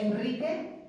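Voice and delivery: "Enrique" slowly, with a trilled R after the N; "Enrique" drawn out with a double-sounding R; strong R. Shown as a demonstration. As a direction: roll the R hard in "Enrique". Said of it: "sonará doble".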